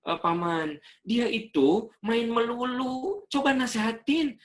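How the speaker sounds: high, slow whiny parent's voice; a parent telling on their child in front of the uncle; complaining parent. Retold or imitated. Parent complaining about the child to the uncle, high and whiny. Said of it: "Kata orang tuanya".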